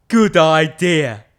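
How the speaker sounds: loud, slow booming skeleton; big skeleton voice. Acted. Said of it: "the big skeleton said".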